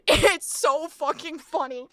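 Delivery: ordinary talk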